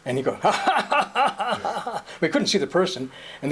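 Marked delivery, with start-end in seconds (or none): 0.4-2.0 high cackling ha ha ha; mock high laugh. Imitating the guy on the tape with a high laugh.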